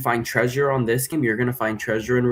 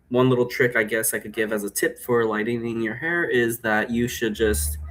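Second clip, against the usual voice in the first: ordinary delivery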